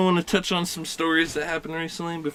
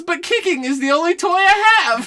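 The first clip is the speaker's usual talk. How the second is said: higher, laughing Bart impression; 10 semitones higher than usual. Retelling the Simpsons joke to friends, high and laughing. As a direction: deliver it like a deadpan kid, through laughter. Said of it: "He goes".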